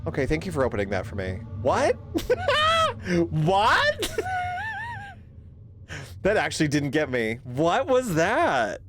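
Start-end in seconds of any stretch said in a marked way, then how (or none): 1.6-2.0 startled what. Real, not acted. Startled by a jump scare. 2.0-3.2 high shrieking startled laugh. Real, not acted. Streamer shrieks with laughter after the jump scare. 3.3-4.1 startled what. Real, not acted. Still reeling from the scare. 4.1-5.1 very high crying, shrieking laugh; screaming laugh. Real, not acted. Streamer wails with laughter, still shaken by the scare.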